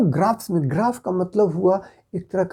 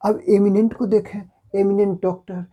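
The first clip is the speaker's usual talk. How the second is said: ordinary talk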